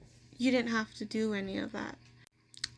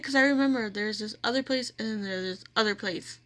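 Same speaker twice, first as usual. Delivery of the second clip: usual speaking voice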